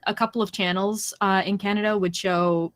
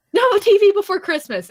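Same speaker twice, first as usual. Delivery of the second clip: high, loud mock scolding; mom yelling; 11 semitones higher, 7 dB louder than usual. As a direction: imitate mom laying down the law. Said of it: "I hear yelling upstairs".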